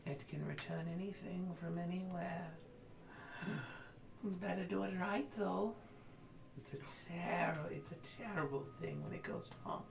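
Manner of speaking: slow eerie seer voice; a hint of a spooky oracle; creepy oracle voice. GM voicing oracle figure.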